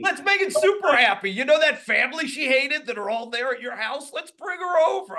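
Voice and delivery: high, gushing mock excitement; mock enthusiasm. Mimicking a friend urging him to invite the ex over, with mock glee. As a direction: parody an eager fool, pitched well up. Mocks friend's naive happy-ending idea.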